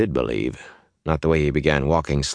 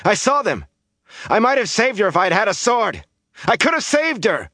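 anguished, high-pitched outburst; raw, impassioned yelling; angry shout; 3 dB louder than usual. A narrator's reading. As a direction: shout it in grief and fury. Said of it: "he shouted angrily".

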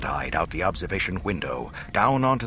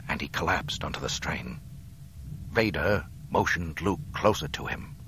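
ordinary delivery

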